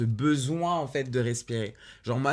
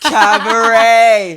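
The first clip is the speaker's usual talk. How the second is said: high, loud, drawn-out shriek; delighted shriek; 12 semitones higher, 19 dB louder, much slower than usual. Real, not acted. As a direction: squeal the title with joy. Shrieking the musical's name.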